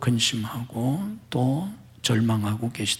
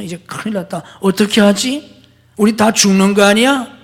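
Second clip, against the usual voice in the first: panicked disciples; 6 semitones higher, 6 dB louder than usual. Pretend. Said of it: "다른 제자들이 그렇게 말했다면".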